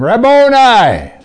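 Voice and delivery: high, loud, drawn-out cry; joyful cry of recognition. Acted. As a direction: call out in sudden joy. Mary recognizing Jesus.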